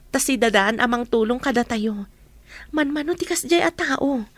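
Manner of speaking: ordinary talk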